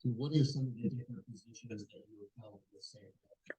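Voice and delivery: faint, garbled bad line; radio breaking up. Real, not acted. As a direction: voice dropping out on the call. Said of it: "now we can't hear your question".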